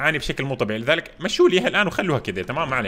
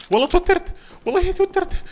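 high, jittery excited burst; rattled and thrilled, pitched up; nervous excitement; 5 dB louder than usual. Real, not acted. (حماس متوتر بصوت عالٍ)

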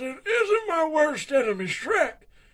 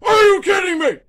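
loud, high rant; furious shout; 4 semitones higher, 9 dB louder than usual. Acted. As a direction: blow up at him in outrage. Dictator outraged at charity.

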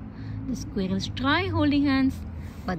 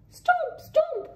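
high, heavy stomp stomp; stomping giant steps. Acted. Narrator voicing Henny's big footsteps, high stomps. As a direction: call out each stomp like a big creature.

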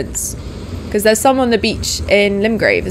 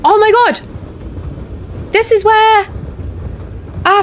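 high, very slow exclamation; put-on awed voice; 13 semitones higher, much slower than usual. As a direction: draw it out, high and amazed. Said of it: "that voice".

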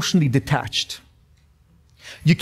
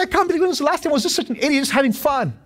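fast, high incredulous self-quote; 11 semitones higher, faster than usual. Retold or imitated. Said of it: "I said".

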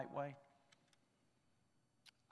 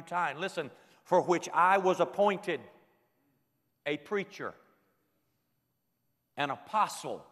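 higher, louder sermon cadence; full-force preaching; 6 semitones higher, 7 dB louder than usual. Acted. Pastor preaching scripture to the gathered pastors, full-force sermon. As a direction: preach it out to the congregation.

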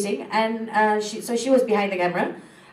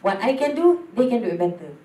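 ordinary talk

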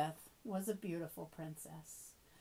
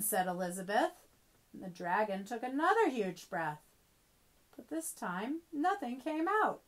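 usual speaking voice

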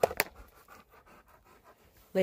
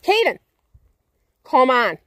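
higher, louder call; calling a name; 4 semitones higher, 7 dB louder than usual. Real, not acted. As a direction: call the dog sharply. Calling dog from afar.

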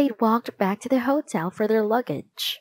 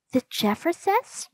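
high-pitched, incredulous echo; surprised question; 8 semitones higher than usual. A narrator's reading. Ruth Rose hears who the suspects are, surprised disbelief. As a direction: query the name in a much higher voice. Girl doubts the named suspects.

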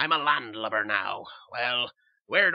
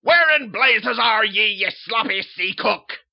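loud, harsh pirate-captain roar; bellowing captain; 8 semitones higher, 15 dB louder, slower than usual. Acted. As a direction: bark orders like a tyrant at sea.

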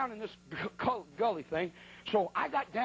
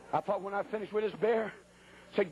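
usual speaking voice

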